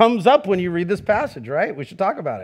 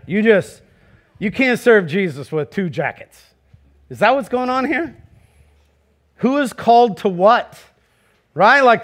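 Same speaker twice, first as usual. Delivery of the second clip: usual speaking voice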